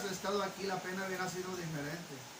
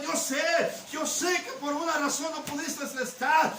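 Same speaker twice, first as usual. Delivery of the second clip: much higher and louder, crying out to the master; anguished shouted plea; 8 semitones higher, 8 dB louder than usual. Acted. Preacher voicing María pleading with Jesus over her dead brother, shouted. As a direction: cry out the grieving sister's plea at full volume. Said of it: "esta mujer es sincera".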